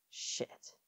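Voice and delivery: under the breath, unvoiced mutter. A narrator's reading. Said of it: "Anacostia muttered".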